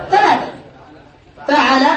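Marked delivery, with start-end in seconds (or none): 1.5-1.9 فعل said fa-'a-la, middle vowel a; fatha on ain. Shown as a demonstration. Teacher listing verb patterns, فعل as fa'ala. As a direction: say فعل with an open a after the ain. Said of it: "بفتح العين".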